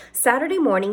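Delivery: plain reading voice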